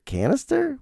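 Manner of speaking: Canister? asked high and doubting; high unsure repeat of Canister?; puzzled canister. Real, not acted. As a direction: question your own word Canister?.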